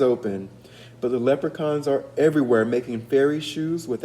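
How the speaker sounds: high, loud weary Irish mother; mother worn out by leprechaun tapping; tired mother voice. Acted. Mother voiced in read-aloud story.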